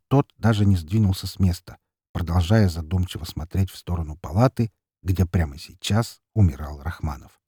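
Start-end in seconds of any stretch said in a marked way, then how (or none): none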